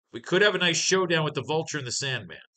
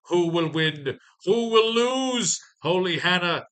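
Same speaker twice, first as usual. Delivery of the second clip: high, loud showdown hype, slow on the stretched words; hyped match announcer; 5 semitones higher, 6 dB louder, slower than usual. Acted. Recapper hyping the goblin versus goblin matchup, mock announcer holler. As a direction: draw it out like a ring announcer.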